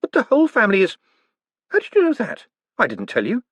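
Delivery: put-on high, outraged young man; blurting a defence; indignant protest. Moist protests.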